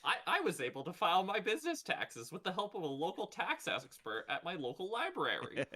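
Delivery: high, loud cheesy commercial testimonial. Pretend. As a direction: gush like a hammed-up ad. Mock library ad testimonial.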